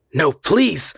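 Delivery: high, loud pained plea. A narrator's reading. The man pleading with the frightened girl, high and pained. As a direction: beg her, hurting. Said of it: "he said painfully".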